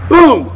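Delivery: loud, high, exclaimed "boom"; imitated boom. Shown as a demonstration. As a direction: punch out a sudden impact sound. Sound effect on hitting core.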